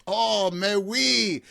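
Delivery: hammed-up French accent, high and slow. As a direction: play a Frenchman in a café, drawn out and lifted. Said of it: "like a Frenchman in a cafe and goes".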